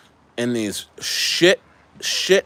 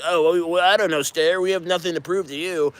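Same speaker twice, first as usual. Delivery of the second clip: mocking the team; 5 semitones higher, 3 dB quieter than usual. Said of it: "they're like".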